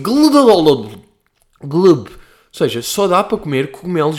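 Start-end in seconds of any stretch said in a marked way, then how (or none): 0.0-2.1 gulping noise. Retold or imitated. Imitating slimy mushroom in mouth.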